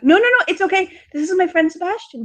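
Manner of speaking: high, bright, rushed reassurance; eager girl. Acted. Daughter introducing her rescuer to dad, high and eager. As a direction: chirp it out to smooth things over.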